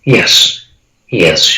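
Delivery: ordinary delivery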